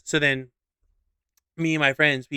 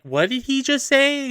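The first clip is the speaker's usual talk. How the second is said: high, incredulous question; mock-astonished young onlookers; imitated shocked students; 9 semitones higher than usual. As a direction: pitch it up in amused disbelief. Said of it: "'Cause they're like".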